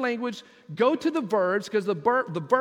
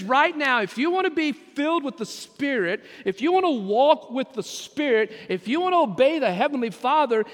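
loud, high, urgent preacher's call; Paul's command shouted to the church; 4 semitones higher, 4 dB louder than usual. Acted. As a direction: hammer it home at full voice.

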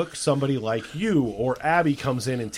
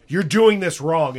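bossy; 4 semitones higher, 7 dB louder, slower than usual. Retold or imitated. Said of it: "She goes".

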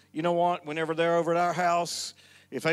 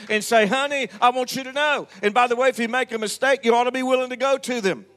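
building louder preacher's exhortation; preaching at full force; 4 semitones higher, 8 dB louder than usual. Acted. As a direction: press the congregation hard.

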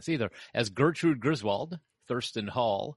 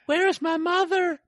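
put-on squeaky child voice; 9 dB louder than usual. Joke bit, put-on voice.

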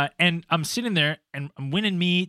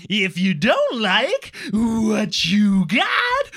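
put-on very slow, louder, strained singing of an old lady; impression of Carol singing, slow and loud; 5 semitones higher, 5 dB louder, much slower than usual. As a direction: drag each word out like a little old lady belting her song. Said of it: "I'll do a little impression".

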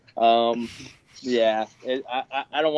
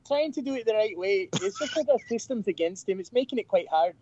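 voicing the Jersey Hole bouncer's backstory, pitched up; bouncer character voice; 6 semitones higher than usual. The Jersey Hole bouncer in a high put-on voice. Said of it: "the bouncer from the Jersey Hole".

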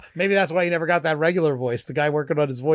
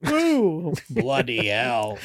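mock British accent; 4 semitones lower, much slower than usual. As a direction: ham up a slow British exclamation. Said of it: "What region of Britain is the accent from?".

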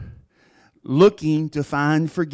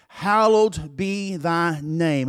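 slow solemn recital; 3 semitones higher, much slower than usual. Acted. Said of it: "he said".

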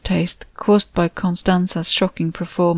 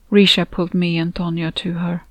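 usual speaking voice

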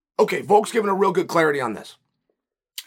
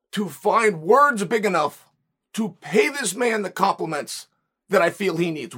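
ordinary delivery

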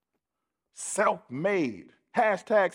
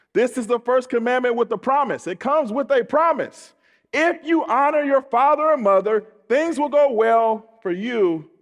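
raised, high-pitched preacher proclamation; full-force preaching; 7 semitones higher, 5 dB louder than usual. Acted. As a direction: proclaim the promise to the congregation.

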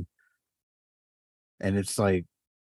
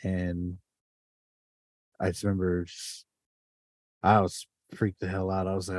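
ordinary talk

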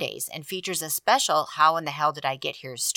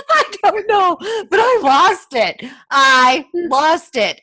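high, loud laughing words; a much higher voice through laughter; 13 semitones higher, 9 dB louder, slower than usual. Real, not acted. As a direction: talk while still cracking up. Teller laughing recalling.

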